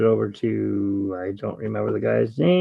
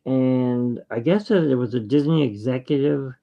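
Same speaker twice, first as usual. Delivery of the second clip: ordinary talk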